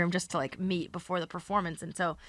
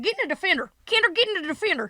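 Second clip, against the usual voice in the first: much higher, fast shouted order; high loud hurried summons; calling out; 11 semitones higher, 5 dB louder, faster than usual. Retold or imitated. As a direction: call across the party to Kendall. Host keeps calling Kendall.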